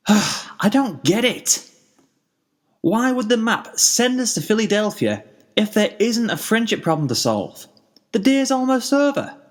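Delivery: put-on a tired kid calling out; Spike, weary. A narrator's reading. Spike tiredly asking passing ponies for problems.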